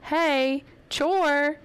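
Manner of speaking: high-pitched call up the stairs; retold holler. Mother reminding daughter upstairs about her chore, retold holler. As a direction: call out as if to another floor, lightly. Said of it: "I holler up the stairs".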